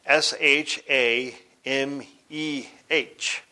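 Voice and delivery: slow, separated letters; spelled out. Shown as a demonstration. Teacher spelling the Hebrew for heaven, slowly letter by letter. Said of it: "It's spelled".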